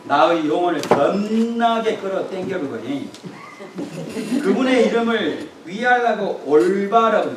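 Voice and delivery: Psalm 23 hammed up in broad Jeolla dialect; preacher playfully reciting the psalm to the congregation, broad Jeolla dialect. 전라도 사투리로 능청스럽게. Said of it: "전라도 버전".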